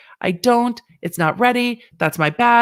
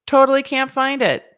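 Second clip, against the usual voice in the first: "Totally" pushed up high and louder with a long T; higher, louder repeat; 6 semitones higher than usual. Shown as a demonstration. Host repeating her complaint, higher and louder. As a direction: sing out "Totally can't find it" higher.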